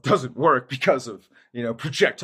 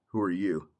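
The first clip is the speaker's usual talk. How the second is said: quiet menacing echo; 4 semitones lower, 7 dB quieter than usual. Retold or imitated. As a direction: lower and softer, cold and threatening. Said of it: "I would just look at 'em and go".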